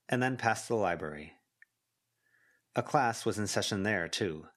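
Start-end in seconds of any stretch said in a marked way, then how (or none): none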